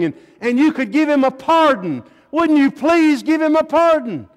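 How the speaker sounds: high loud begging, rising in despair; weeping plea. A narrator's reading. Mother on her knees begging the governor for a pardon, rising desperate plea. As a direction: plead for her son's life, voice climbing. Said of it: "began to weep and began to beg".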